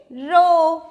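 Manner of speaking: रो loud, with the "oh" held; teacher's model रो with a stretched "oh"; long O sound. Shown as a demonstration. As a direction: draw out रो as "rohh". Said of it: "तो ये है".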